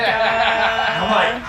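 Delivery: ordinary talk, nothing marked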